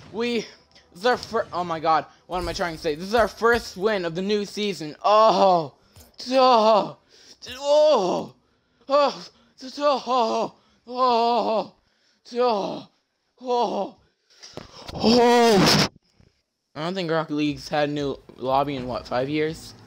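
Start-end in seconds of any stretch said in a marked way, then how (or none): 5.0-15.9 loud high oh's; repeated amazed yelps; excited exclamations. Real, not acted. Reacting to lobby.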